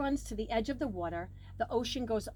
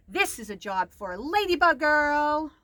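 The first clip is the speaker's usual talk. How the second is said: louder, grand superhero announcement; heroic proclamation; 5 semitones higher, 9 dB louder than usual. A narrator's reading.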